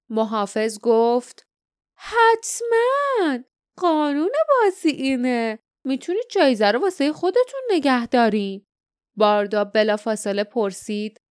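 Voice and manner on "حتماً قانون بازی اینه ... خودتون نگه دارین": theatrical guardian. Acted. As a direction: play a scheming game master, voice pitched up. Guardian swears to the game's rules.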